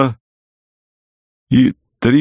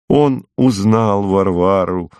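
ordinary delivery, nothing marked

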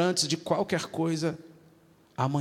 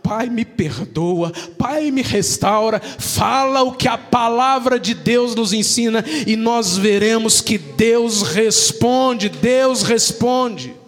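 loud, slow, building preacher's plea; loud, stretched-out sermon cry; fervent preaching; 7 semitones higher, 10 dB louder, slower than usual. Acted. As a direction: proclaim it with full force. Preaching at full force.

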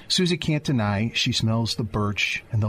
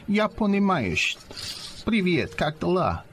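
mock Russian accent; 7 semitones higher than usual. Husband practicing Russian in a Siberian bar, put-on Russian accent. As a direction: ham up a tourist's Russian accent. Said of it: "I'm just practicing my Russian".

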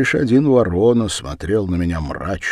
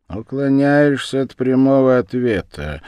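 raised, needling accusation; higher, louder, pointed; barbed; 7 semitones higher, 6 dB louder than usual. A narrator's reading. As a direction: prod them with a cutting challenge. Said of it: "внезапно колко процедил Ворона".

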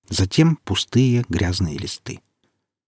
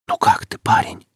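very high, sly ghostly whisper; luring spirit whisper. Acted. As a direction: coax him like a hidden spirit. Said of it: "раздался чей-то вкрадчивый шёпот".

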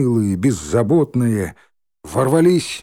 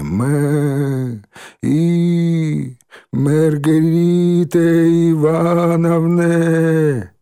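high, slow bleating call; bleating sheep; 6 semitones higher, 3 dB louder, much slower than usual. Acted. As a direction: bleat the name like a sheep, stretched. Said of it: "понеслось блеяние".

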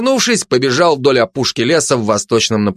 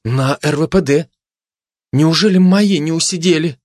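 high, lightly wailing worried mother; mild high-pitched lament; moaning; 6 semitones higher than usual. Acted. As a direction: moan it in dismay. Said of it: "простонала мать".

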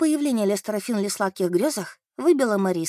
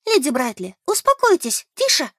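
high, louder plea for calm; urgent hushing; 5 semitones higher, 5 dB louder than usual. A narrator's reading. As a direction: anxiously try to quieten her. Urgently hushing a shouting old lady. (взволнованно успокаивает)

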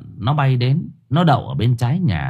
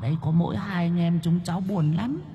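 put-on high, soft child's voice; pleading child; 7 semitones higher, 10 dB quieter than usual. A child asks an adult to let the siblings tag along, pleading. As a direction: plead softly like a small child.

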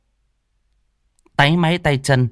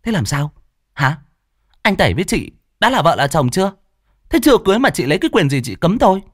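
high, rapid-fire comeback; indignant retort; 7 semitones higher, faster than usual. A narrator's reading. Scolded rival challenging the other woman's rights, indignant retort. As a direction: fire back fast, pitch climbing. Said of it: "bị mắng cũng không nhịn được".

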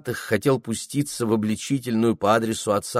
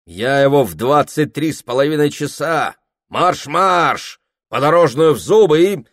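higher, louder furious outburst; angry shout; 7 semitones higher, 7 dB louder than usual. Acted. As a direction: bark it in a rage. Prokhor rages at his wife.

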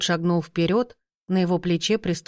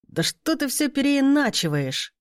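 exasperated outburst; 8 semitones higher than usual. Acted. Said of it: "топнул в сердцах ногой Мороз".

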